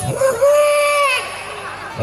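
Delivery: very high, strained yelp; startled cry. Retold or imitated. Grandma jolted at maghrib prayer, high shriek.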